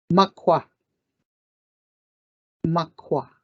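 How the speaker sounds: "Makwa" stressed on the first syllable, ah vowels; Ojibwe word makwa. Shown as a demonstration. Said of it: "That's how we say bear".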